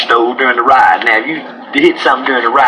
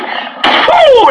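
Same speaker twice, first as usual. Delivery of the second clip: high whooping cheer; sudden falsetto whoop; celebratory woo. Acted. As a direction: whoop like you hit the jackpot. Celebrating the payout.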